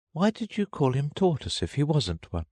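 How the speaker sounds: put-on high, inquiring girl's voice; Alice voice. A narrator's reading. Alice questioning the turtle about his master, in a high girlish voice. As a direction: ask high and light, as a curious child.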